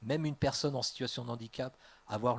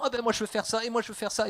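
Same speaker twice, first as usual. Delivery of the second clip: much higher, eager voice; eager volunteers; 9 semitones higher, faster than usual. Acted. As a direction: clamour to join in like keen newcomers. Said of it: "qui arrivent en disant".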